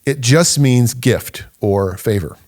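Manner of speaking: slow, leaning on just; slow, deliberate stress on just; slow emphatic stress. Shown as a demonstration. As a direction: press each word down slowly. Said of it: "and I stress the word simply".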